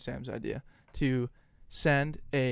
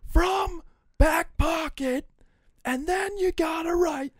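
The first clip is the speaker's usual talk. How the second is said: much higher, slow, louder yell over the noise; shouting into the phone; 7 dB louder, slower than usual. Retold or imitated. Caller shouting a request over a noisy kitchen, straining yell. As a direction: yell it so the pizza guy hears. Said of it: "keep getting louder".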